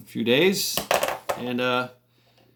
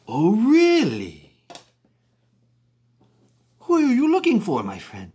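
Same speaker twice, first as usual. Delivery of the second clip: voicing Tam; 9 semitones higher, 4 dB louder than usual. Local man sounding out the stranger at the bar, exaggerated interest. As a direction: stretch it high, then drop on the last words. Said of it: "And Tam was like".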